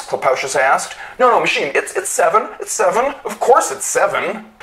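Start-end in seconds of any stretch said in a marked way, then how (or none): 1.2-4.5 much higher, hurried placating; desperate to calm the machine; panicked pleading. A narrator's reading. Clopacius frantically begging the machine, much higher voice.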